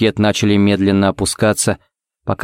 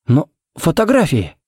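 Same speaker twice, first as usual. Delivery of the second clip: high, distraught objection; desperate protest; 7 semitones higher than usual. Acted. Pharmacist refusing to accept the news, desperate protest. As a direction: keep protesting, voice rising. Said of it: "не унимался Коннорс".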